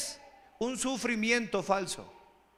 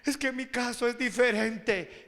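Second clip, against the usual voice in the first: self-pitying sufferer; 3 dB quieter than usual. Acted. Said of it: "usted poniéndose a sufrir".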